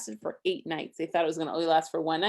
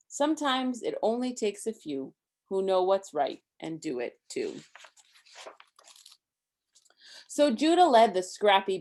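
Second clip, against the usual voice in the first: story reading; 2 semitones higher, 4 dB louder than usual. A narrator's reading. Parent reading a Hanukkah book to Isaac, lively rhyme. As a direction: read the rhymes to a child with bounce.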